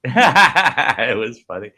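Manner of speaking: slow, laughing through the words; amused laughing repeat. Real, not acted.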